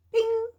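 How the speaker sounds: high, loud ringing "bing"; ping it out suddenly, high; snapping "bing". A narrator's reading. Storyteller as a rope strand breaks, sudden bing.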